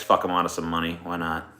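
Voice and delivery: ordinary delivery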